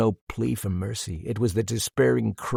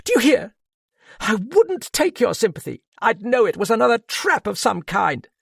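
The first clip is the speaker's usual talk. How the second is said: much higher, tearful, cried out against sobs; fighting sobs; 11 semitones higher, 5 dB louder than usual. A narrator's reading. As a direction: hold back the sobs while crying out. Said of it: "fighting those sobs".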